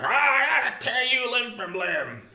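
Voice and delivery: high, loud, slow hammed-up threat; mock raging attacker. Pastor imagining an angry attacker, hammed-up loud threat. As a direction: growl it like a cartoon brute. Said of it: "if somebody was like".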